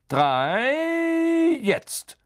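high, stretched count, then go; drawn-out countdown cue. A narrator's reading. Lupin to Neville before opening the cupboard, drawn-out countdown cue.

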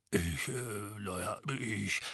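low, quiet, halting; very ill at ease. A narrator's reading. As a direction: hesitate guiltily under accusation. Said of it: "schien es äußerst unwohl".

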